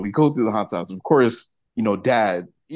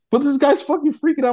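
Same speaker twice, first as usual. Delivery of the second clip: high, loud exasperated burst; telling it with rising disbelief; worked-up retelling; 5 dB louder than usual. Retold or imitated. Teasing friends about a scared kid, high and loud.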